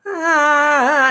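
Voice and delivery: high startled ah; a playful imitated fright; mock spooked gasp at a noise. Woods make weird noises.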